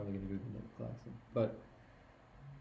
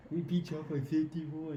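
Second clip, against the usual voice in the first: taunting; 6 semitones higher, slower than usual. Real, not acted. Taunting rival fan.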